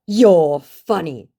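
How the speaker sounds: high, loud, very slow; exaggerated put-on scammer voice; mock scammer. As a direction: draw it out as the scammer. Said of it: "he said".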